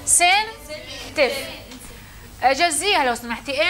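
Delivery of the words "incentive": incentive drawn out very slowly; teacher says incentive slowly, syllable by syllable; slow model word. Shown as a demonstration. Teacher models a new term for students, slowly. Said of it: "شو معنى تحفيز باللغة الإنجليزية".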